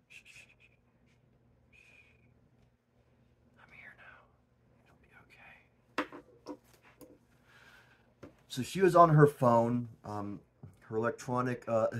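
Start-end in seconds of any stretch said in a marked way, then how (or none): 0.1-6.5 unvoiced hush, slow and soothing; soothing whisper. Hushing the viewers after the rant, whispered. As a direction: whisper like calming a scared child.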